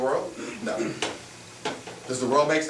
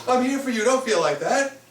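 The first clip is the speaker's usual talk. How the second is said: higher, sing-song consoling friend voice; mock comforting friend; 10 semitones higher than usual. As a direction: imitate a friend soothing you, pitched up. Friends comforting someone abandoned.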